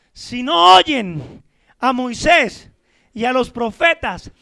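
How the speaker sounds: loud, stretched-out proclamation; forceful preaching. Acted. Preacher reads Abraham's warning to the rich man, loud and slow. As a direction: drag each word out and press it home.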